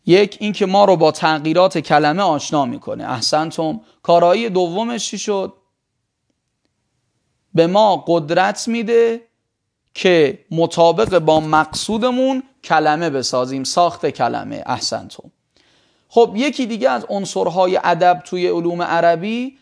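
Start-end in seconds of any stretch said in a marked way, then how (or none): none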